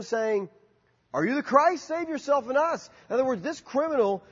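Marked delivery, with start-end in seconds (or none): 1.1-2.9 mocking. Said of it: "were hurling abuse at him".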